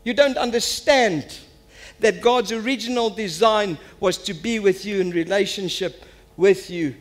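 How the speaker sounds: high, loud, driving sermon voice; impassioned preaching. Real, not acted. Preacher asks church why they follow Jesus, impassioned preaching. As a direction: press each point urgently on the congregation.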